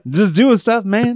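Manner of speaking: high, loud mock-innocent reply; hammed-up defensive brush-off; caught-out worker. Acts reply to the agents.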